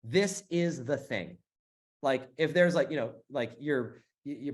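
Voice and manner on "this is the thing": slow, deliberate, every word weighted; emphatic. Real, not acted. Host insisting to viewers this is it, slow and emphatic. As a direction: spell it out word by word. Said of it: "I don't know how to say it more emphatically".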